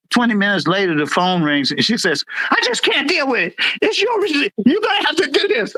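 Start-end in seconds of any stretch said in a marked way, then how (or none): 2.5-5.7 high-pitched imitation of a rattled boss; flustered refusal. Sergeant imitates boss phoning after fleeing, flustered refusal. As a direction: rush it out, voice way up, backing away.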